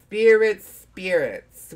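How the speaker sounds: high, loud mock seance call; spooky summoning voice. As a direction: call the spirits, hammy and eerie. Summoning spirits with candle.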